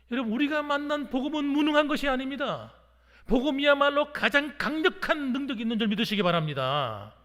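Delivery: loud, emphatic preaching; rousing pulpit proclamation; forceful exhortation. Real, not acted. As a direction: declare it at full force to the congregation. Preacher urges congregation to believe.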